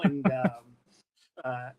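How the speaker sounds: usual speaking voice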